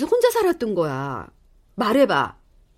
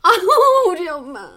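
laughing joy; 8 semitones higher, 5 dB louder, slower than usual. Acted. Hugging mother, laughing.

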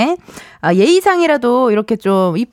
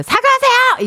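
much higher indignant demand; much higher, sharp mock-offended catchphrase; her sketch character's catchphrase; 14 semitones higher than usual. Shown as a demonstration. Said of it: "제가 지금 하고 있는 코너의 그 캐릭터".

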